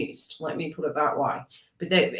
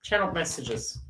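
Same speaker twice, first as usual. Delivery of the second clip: normal talking voice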